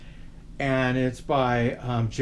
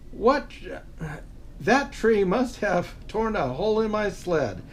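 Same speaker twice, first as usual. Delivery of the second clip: higher, startled realization; surprised Santa; 5 semitones higher than usual. Acted. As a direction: Santa suddenly catching on. Santa realizes sleigh damage.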